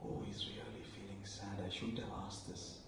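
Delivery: put-on a girl's pitying whisper to the teacher. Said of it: "I could hear this girl whispering to the teacher".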